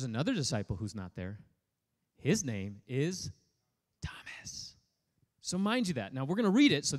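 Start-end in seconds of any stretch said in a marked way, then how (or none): 2.2-4.8 whispered name. Acted. Dramatic reveal of missing disciple.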